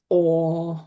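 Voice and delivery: low, drawn-out touched "Aw"; uncle's grateful "Aw" at a present; grateful Māori uncle. Acted. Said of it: "He's very grateful and he says".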